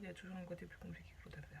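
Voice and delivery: fast whispered aside; whispering. Real, not acted. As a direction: murmur it quickly under the breath.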